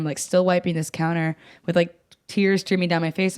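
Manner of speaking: ordinary talk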